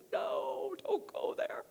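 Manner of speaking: high, squeaky strained imitation of a mom; high-pitched mock-panicked mother; alarmed mom. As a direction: squeak it out, dodging the question. Acts out mom dodging daughter's question.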